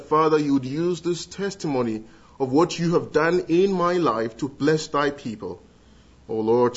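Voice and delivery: ordinary delivery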